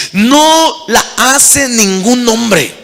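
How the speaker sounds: every word laid down slowly and heavily, NO leading; slow emphatic repeat. Acted. Preacher repeating to the congregation, slow and heavy on "No". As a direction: hammer home "No la hace ningún hombre" word by word.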